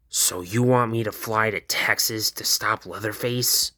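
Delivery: normal talking voice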